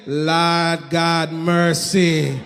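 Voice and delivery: low, drawn-out Jamaican admiration; Jamaican accent. Retold or imitated. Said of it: "like a Jamaican man".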